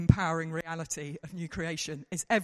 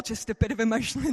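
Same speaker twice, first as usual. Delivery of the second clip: normal talking voice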